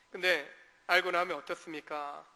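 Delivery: usual speaking voice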